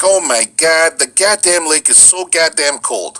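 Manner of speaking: put-on Michigan accent. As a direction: slowly stretch it out in broad Michigan. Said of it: "one of my best friends is from Michigan".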